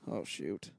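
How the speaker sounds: low, soft dismayed aside; muttered oh shoot. Retold or imitated. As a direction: drop the voice in quiet dismay. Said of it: "I'm like".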